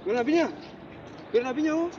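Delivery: ordinary delivery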